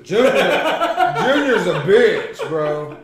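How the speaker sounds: high, loud, slow laughing insult; laughing tease. Real, not acted. Teasing Junior about the salsa, high, loud and slow through laughter.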